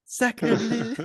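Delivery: hammy French voice; French accent. Pretend. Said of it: "generic French voice".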